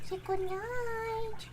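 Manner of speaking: high, very slow coaxing call; drawn-out pet talk. Real, not acted. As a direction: stretch each word out to the cat.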